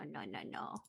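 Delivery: ordinary delivery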